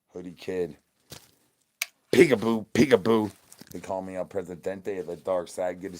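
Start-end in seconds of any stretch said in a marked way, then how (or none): 2.1-3.3 a sing-song tease, punched loud; loud playful peekaboo. Acted. Streamer teasing the chat, loud playful peekaboo. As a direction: call it out loud and teasing, like popping out of hiding.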